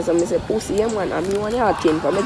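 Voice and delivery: usual speaking voice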